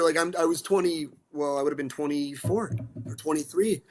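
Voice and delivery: ordinary delivery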